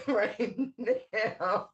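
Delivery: words carried on a laugh. Real, not acted. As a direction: break into a laugh as you finish. Laughs while finishing the remark.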